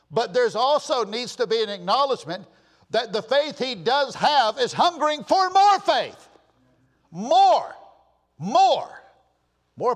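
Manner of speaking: high preaching building to a loud shout; full-force preaching. Real, not acted. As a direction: build up, then belt it out.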